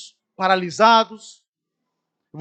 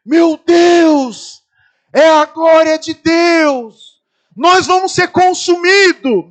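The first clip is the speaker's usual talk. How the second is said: higher, louder, slow mock cry of awe; retold shout; 5 semitones higher, 8 dB louder, much slower than usual. Fellow on the mountain shouting in awe at the fog, hammed-up. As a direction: shout it like doom has come in the fog. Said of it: "um abençoado grita assim".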